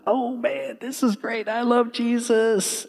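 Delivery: happy believer. Pretend. Preacher voicing a believer with eyes on God, high and gushing. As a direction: gush like a carefree new believer.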